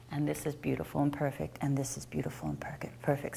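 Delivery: breathy hushed praise, fading to a whisper; soft whisper. Acted. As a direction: drop the voice to a gentle whisper. Instructor drops to soft whisper.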